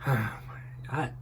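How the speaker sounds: a whispered groan of disbelief; exasperated whisper. Real, not acted. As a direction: breathe it out, voiceless, fed up. Exasperated aside in a rant.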